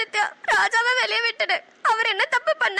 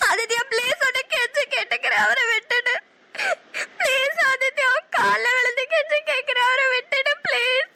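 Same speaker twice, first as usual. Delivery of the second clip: crying plea; 3 semitones higher than usual. Acted. Woman begging the villain for her lover, crying and pleading. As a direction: beg through tears, falling at his feet.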